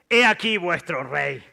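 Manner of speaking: slow, loud public presentation; loud proclamation. A narrator's reading. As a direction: proclaim it slowly to the crowd. Pilato presenta al rey al pueblo. (proclamación lenta y fuerte)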